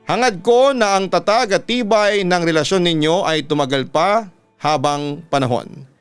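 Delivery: usual speaking voice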